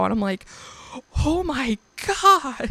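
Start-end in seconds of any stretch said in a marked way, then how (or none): 1.2-2.7 slow, high and overwhelmed; awestruck. Retold or imitated. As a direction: let the awe drag out. Freaking out about dinosaurs.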